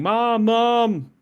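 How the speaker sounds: high, loud mock child's cry; imitating children screaming for mom; kids screaming. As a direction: scream for mom like a scared kid. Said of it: "we hear the kids screaming".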